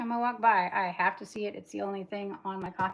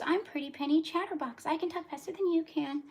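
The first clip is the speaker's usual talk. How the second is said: high-pitched talking doll imitation; Pretty Penny Chatterbox; 7 semitones higher than usual. Woman mimics the doll she hated as a child, high mocking doll voice. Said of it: "you'd pull her hair and she goes".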